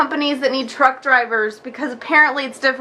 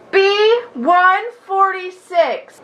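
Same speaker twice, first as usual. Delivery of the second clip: slow, drawn-out clerk call, higher; number caller; 5 semitones higher, much slower than usual. Retold or imitated. Clerk calling numbers to the waiting room, slow and higher. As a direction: stretch every syllable like a waiting-room announcer.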